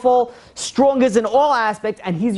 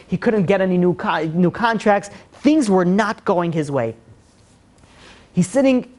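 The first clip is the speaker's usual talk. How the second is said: ordinary delivery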